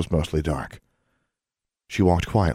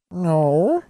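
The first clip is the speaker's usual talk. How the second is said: high squeaky elf, lightly hesitant; a hesitant little elf no; 12 semitones higher, 3 dB louder than usual. Acted. House elf Nina hesitantly denying, squeaky and unsure. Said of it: "Nina said hesitatingly".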